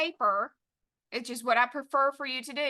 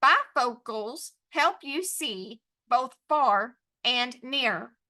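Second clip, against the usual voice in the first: "Bifocals help you see" in slow separate beats; pounded word by word; slower than usual. Shown as a demonstration. Teacher dictating to students, pounded word by word. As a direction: pound out each word from "Bifocals" to "near", pausing between. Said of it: "Pound it by yourself".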